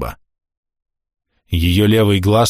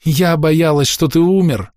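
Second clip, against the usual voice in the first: tearful; 8 semitones higher, slower than usual. A narrator's reading. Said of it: "потом расплакалась".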